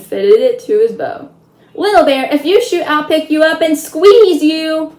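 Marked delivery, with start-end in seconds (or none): 1.7-4.9 high, loud urgent threat; boy shouting a desperate threat at his toy Indian; crying out. Acted. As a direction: yell a warning to stop him shooting. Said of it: "Omri cried".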